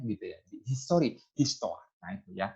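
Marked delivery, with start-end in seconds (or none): none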